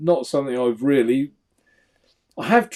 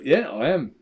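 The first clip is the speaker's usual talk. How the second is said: usual speaking voice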